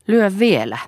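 high, loud dare; defiant. A narrator's reading. Son straightening up after father's blow, defiant. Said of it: "sanoi Poju ja suoristautui".

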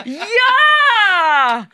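long, very high scream; loud stretched squeal; shriek. A narrator's reading. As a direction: yell it as loud as two kids at once. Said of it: "kiljaisivat Liisa ja Krista yhteen ääneen".

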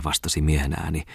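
usual speaking voice

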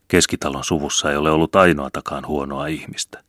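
usual speaking voice

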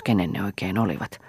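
usual speaking voice

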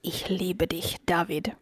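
soft breathy German whisper, very quiet; whispered love confession. Retold or imitated. Whispering a love confession to the hairstylist David. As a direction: breathe it like a secret love note. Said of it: "så skulle jag viska åt honom".

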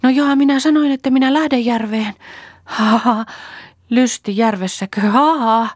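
high, loud, heated outburst; frantic, high-pitched protest; feverishly eager. A narrator's reading. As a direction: cry it out high with mocking ha-has. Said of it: "kiihkeästi jatkoi".

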